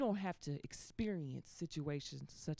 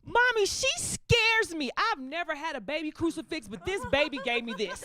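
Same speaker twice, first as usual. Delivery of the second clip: hammed-up terror, starting high and stretched; mock frightened shriek; 6 semitones higher than usual. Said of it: "she was like".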